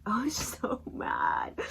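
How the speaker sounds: slow, laughing admission; helpless giggle on the words. Real, not acted. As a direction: say it through real laughter, drawn out. Laughing at own past anger.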